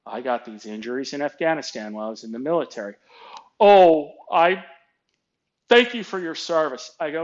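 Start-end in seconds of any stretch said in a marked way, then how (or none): 3.6-6.9 higher, louder, halting thanks; flustered boss. Retold or imitated. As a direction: imitate a boss backpedaling awkwardly.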